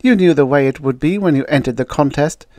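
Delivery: acted mild anger; angry. A narrator's reading. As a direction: tell her off crossly. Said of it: "said the redhead angrily".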